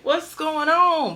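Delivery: high, slow puzzled question; mock confusion. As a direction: sound baffled by the commotion. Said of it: "everybody looking like".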